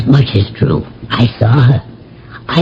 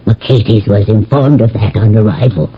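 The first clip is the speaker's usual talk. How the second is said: usual speaking voice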